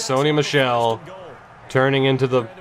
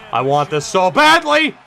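louder, higher cry of longing; desperate shout; 5 semitones higher, 4 dB louder than usual. Real, not acted. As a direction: shout how badly you want it. Desperate for the play to work.

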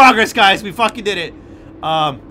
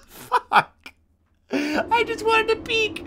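whine; 13 semitones higher, 4 dB quieter, much slower than usual. Real, not acted. Whining after getting caught.

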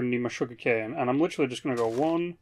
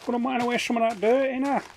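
very high mock Scouse voice; hammed-up Scouse impression; Scouse chav accent; 9 semitones higher than usual. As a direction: put on a silly high-pitched Scouser. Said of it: "Scousers are like the ultimate chavs".

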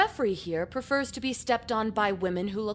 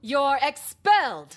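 loud, dragged-out announcement; loud slow verdict; 4 semitones higher, 5 dB louder, slower than usual. Acted. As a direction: bellow the punishment slowly.

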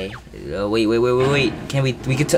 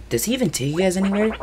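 high fast character voice; 8 semitones higher, faster than usual. Acted. Voicing a minion.

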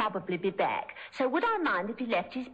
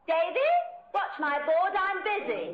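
raised voice calling down the hall; 9 semitones higher than usual. Acted. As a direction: call to Davy across the house. Calling out to Davy downstairs.

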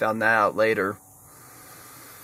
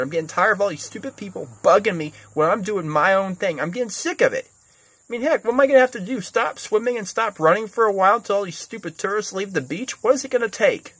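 fed-up rant; 7 semitones higher than usual. Real, not acted. Escalating irritated rant.

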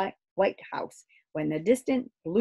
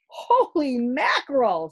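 astonished sailor; 7 semitones higher, 6 dB louder than usual. Acted. Father astonished at the creature.

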